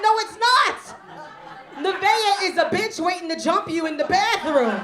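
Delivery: high and loud, laughing through the insult; hammed-up indignant comeback; laughing defiance. Mom refuses son's correction, loud and laughing.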